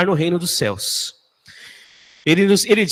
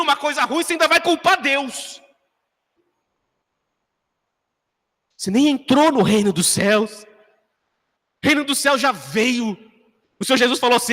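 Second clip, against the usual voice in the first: rising preaching; 6 semitones higher than usual. Acted. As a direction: preach it with building fervour. Preacher builds to a climax.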